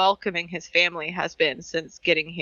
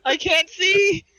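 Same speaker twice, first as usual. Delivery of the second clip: high, slow, tear-choked words; sobbing so hard she can't see; crying; 11 semitones higher, 4 dB louder, slower than usual. Real, not acted. Crying host wiping her eyes, high tearful voice. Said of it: "I was holding it together until I saw [name] break".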